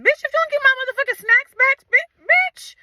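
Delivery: very high, squealed threat; shrieking high-pitched indignation; outraged screech. Retold or imitated. Retold outrage at teacher grabbing snacks.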